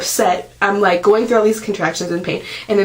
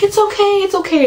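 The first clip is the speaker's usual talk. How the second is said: much higher, cooing reassurance; soothing nurse; 12 semitones higher than usual. Retold or imitated. Nurses soothing the crying mother in labour, in a high cooing voice. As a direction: imitate nurses calming a crying patient. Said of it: "I started crying".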